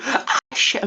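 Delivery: gasping as if chased; mock scared gasp. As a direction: pretend fright, high breathy gasp. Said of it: "I'm being chased by an Outlast villain".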